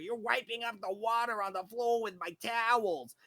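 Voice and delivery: mock the lawyer's whiny accusation; neurotic whiny voice. Lawyer whining at the maintenance worker over his towels. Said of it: "The fucking neurotic, whiny, like, voice".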